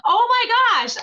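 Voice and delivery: overjoyed astonishment, let it burst out; stunned delight. Retold or imitated. A learner mistaken for a native Italian, overjoyed. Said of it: "And I'm like".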